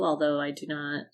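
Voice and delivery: ordinary talk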